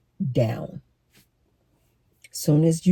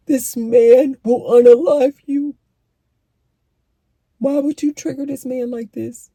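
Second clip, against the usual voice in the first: incredulous; 8 semitones higher than usual. Retold or imitated. Reacting to someone provoking a man, high and incredulous. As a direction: gasp at how reckless that was. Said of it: "I'm like".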